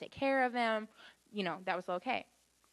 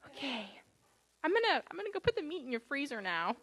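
tentative, higher-pitched retold announcement; mock wary; 6 semitones higher than usual. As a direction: edge away carefully from a scary dog, half joking. Retelling backing away from scary dog.

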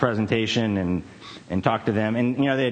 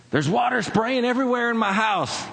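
high-pitched panicked elderly lady, hammed up; mock frantic, pitched way up; retold panic in a high put-on voice; imitating frantic caller; 12 semitones higher than usual. Retold frantic caller reporting a flood.

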